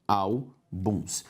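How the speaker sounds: "álbuns" split as ál, buns; a clear pause inside "álbuns"; syllable by syllable. Shown as a demonstration. Teacher separating the word for students, syllable by syllable. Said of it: "Se eu separo álbuns".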